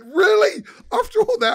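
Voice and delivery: very high, loud mimicked disbelief; incredulous retort. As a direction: imitate someone squawking in outraged disbelief. Retold incredulous retort in quarrel.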